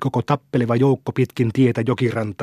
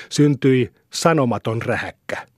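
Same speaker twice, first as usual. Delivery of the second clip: usual speaking voice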